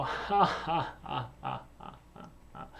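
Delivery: ha ha from the belly; full-body laugh. Shown as a demonstration. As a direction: laugh with the whole body, fading out. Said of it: "We go".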